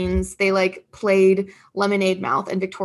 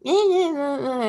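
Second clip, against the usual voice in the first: high mock cheer, sliding down; high-pitched crowd impression; imitated internet crowd; 5 semitones higher than usual. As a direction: squeal it like a whole fandom cheering. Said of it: "The whole internet was like".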